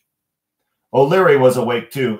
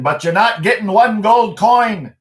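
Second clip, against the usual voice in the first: much higher, louder leprechaun snap; rising angry; 10 semitones higher, 5 dB louder than usual. Acted. O'Leary refusing Michael the gold, angry. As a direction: put your foot down hard. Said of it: "his voice rising".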